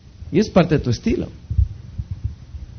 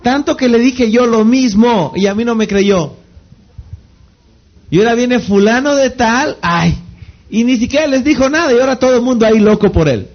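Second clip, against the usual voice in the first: higher, fast, mock-indignant grumble; imitating a complaint; 5 semitones higher than usual. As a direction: play the frustrated listener who was ignored. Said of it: "siempre se hagan la pregunta". (queja imitada, aguda y rápida)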